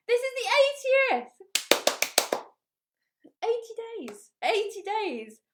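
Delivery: high, loud burst of excitement; real delight at the eightieth show; excited. Real, not acted. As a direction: squeal it, thrilled at the milestone. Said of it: "I'm very excited".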